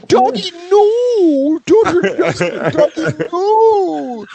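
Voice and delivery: very high, slow, falsetto wail; hammed-up frantic plea to the dog; mock panicked shriek. Hot guy begging the dog to stop, shrill falsetto wail. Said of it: "the hot guy that was suing, going".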